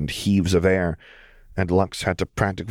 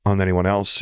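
usual speaking voice

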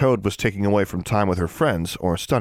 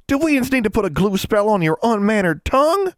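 high, indignant scolding; scolding threat; 14 semitones higher, 4 dB louder than usual. A narrator's reading. Older sister rebuking the youngest, scolding. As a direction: threaten a punishment, pitched well up.

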